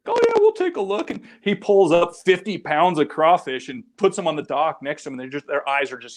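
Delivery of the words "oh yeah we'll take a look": high, mock-eager old fishermen's voice; imitating old men. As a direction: pitch way up, playing the curious old boys. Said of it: "they're like".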